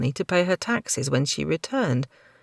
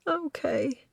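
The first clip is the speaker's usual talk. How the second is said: sniffling okay; 10 semitones higher, 5 dB quieter than usual. Acted. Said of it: "she sniffed".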